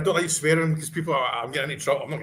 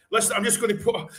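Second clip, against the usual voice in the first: ordinary delivery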